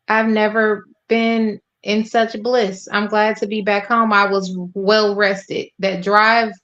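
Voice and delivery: usual speaking voice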